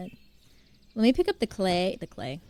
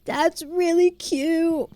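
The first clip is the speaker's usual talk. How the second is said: slow, high cooing; slow, tender coo; tender gush; 7 semitones higher, much slower than usual. Real, not acted. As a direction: melt over something sweet. Gushing over son's words.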